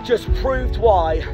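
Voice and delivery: ordinary delivery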